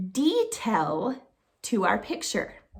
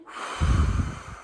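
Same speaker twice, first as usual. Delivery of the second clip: dragon breath. Shown as a demonstration. Teacher showing kids a dragon breath out. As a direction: blow out like a dragon. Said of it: "Breathe out like a dragon".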